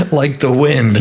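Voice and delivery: a hint of the old man egging him on; director's call. Old director shouting to actor on runaway horse, mild mock call. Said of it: "He says".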